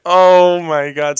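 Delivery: loud, high, slow oh my God; a loud, drawn out exclamation; excited outburst. Real, not acted. Sudden outburst on the recording.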